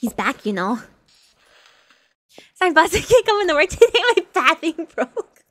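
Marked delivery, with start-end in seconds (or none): 2.6-5.4 higher, quick, breaking into laughter; laughing mock excuse. Pretend worker begging off work to the boss, laughing. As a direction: giggle through a feeble excuse.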